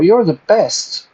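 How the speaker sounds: mock flattery. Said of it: "It's like".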